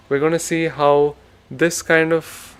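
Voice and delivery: ordinary delivery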